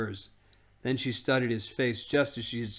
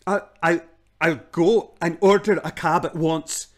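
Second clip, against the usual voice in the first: gasping; 5 semitones higher, 8 dB louder than usual. Acted. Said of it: "gasped Uncle Andrew".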